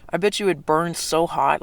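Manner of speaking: ordinary talk, nothing marked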